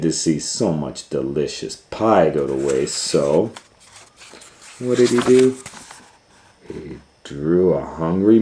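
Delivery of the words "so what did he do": plain reading voice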